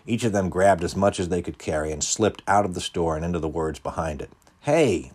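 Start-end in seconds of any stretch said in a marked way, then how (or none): 4.6-5.1 loud sharp call of Hey; shout. A narrator's reading. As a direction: yell after fleeing kids. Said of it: "the manager shout".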